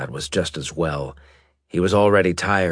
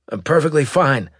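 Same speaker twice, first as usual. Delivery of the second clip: wheezing; 8 semitones higher, 4 dB louder than usual. Acted. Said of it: "Fletcher wheezed".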